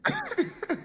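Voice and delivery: very high, soft burst of laughter; short squeaky laugh; high giggle. Real, not acted. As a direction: giggle mid-story. Laughs while telling the story.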